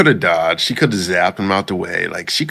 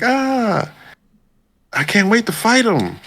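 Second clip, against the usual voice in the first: much higher, eager mock hero voice; character voice. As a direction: play the overeager fighter in a squeaky voice. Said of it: "He's like".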